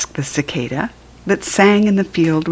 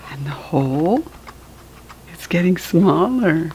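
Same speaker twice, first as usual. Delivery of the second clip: plain reading voice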